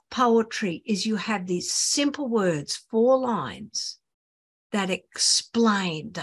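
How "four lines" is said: ordinary talk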